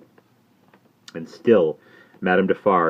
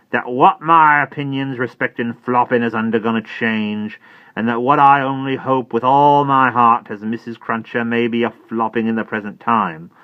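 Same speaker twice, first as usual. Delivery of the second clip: preachy Jerry; 6 semitones higher, 7 dB louder than usual. Acted. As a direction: hold forth like a preacher. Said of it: "hold forth as from a pulpit".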